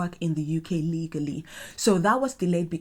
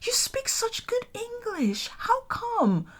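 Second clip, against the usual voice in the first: high, patronizing mock surprise; condescending Londoner; 14 semitones higher than usual. Imitating Londoners praising her English, high and patronizing. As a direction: mimic their fake amazement. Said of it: "Sometimes they're like".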